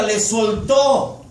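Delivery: soltó drawn out very slowly, heavy fall on soltó; slow emphasis. Shown as a demonstration. Preacher stresses God's forgiveness, very slow. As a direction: stretch le soltó out, weighing each syllable. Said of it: "dice la Biblia".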